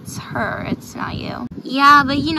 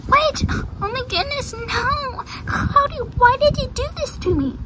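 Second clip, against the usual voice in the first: very high, hammed-up horse dismay; dismayed whine; 11 semitones higher than usual. Horse tricked by Cody's joke, high dismayed whine. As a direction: wail it at the one who tricked you.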